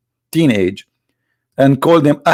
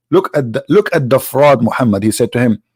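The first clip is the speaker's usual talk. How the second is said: ordinary talk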